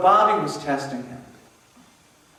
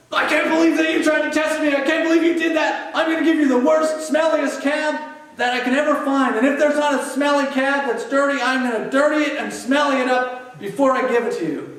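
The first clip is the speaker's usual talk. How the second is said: much higher, louder angry yelling; furious rant; 7 semitones higher, 3 dB louder than usual. Retold or imitated. Louis to Bobby, furious yelling about the worst cab. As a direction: rage at him, voice pitched way up.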